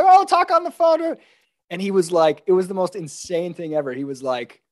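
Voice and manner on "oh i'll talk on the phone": high, louder, airy brush-off; breezy dismissive mock. To the fire marshal, a high mocking brush-off. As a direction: toss it off like he doesn't matter.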